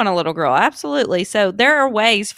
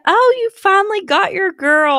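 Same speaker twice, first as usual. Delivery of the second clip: high, gushing congratulation; gushing lady; 11 semitones higher, 4 dB louder than usual. Retold or imitated. Mother quotes a lady mistaking her son, high gush. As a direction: imitate a stranger cooing over a baby. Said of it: "one lady said".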